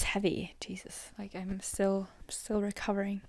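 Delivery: breathy unvoiced whisper, drained; exhausted whisper. Real, not acted. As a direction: whisper it as if still spent. Drained after a heavy episode.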